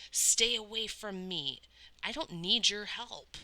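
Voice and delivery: put-on furious boy shouting him off; screamed. A narrator's reading. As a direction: push him away with a yell. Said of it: "he screamed".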